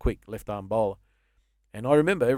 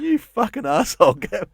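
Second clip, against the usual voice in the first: self-scolding; 10 semitones higher, 7 dB louder, slower than usual. Retold or imitated. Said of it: "I've gone".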